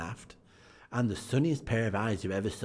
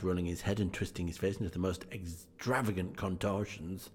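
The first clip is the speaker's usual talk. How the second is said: usual speaking voice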